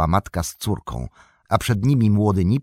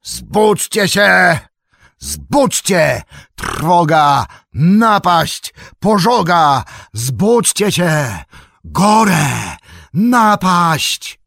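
high, full-voiced long-drawn shouts; alarm cry; 11 semitones higher, 9 dB louder, much slower than usual. A narrator's reading. Horn call rousing Buckland to fire and attack, alarm cry. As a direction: raise the alarm, stretching out every word. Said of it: "aż powietrze drży od okrzyku".

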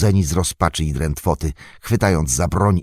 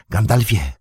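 Pippin calls across the courtyard, a first raised call; 5 semitones higher than usual. Acted. Pippin hails the wizard in the courtyard, a first call. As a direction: call his name to catch his attention. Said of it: "krzyknął Pippin".

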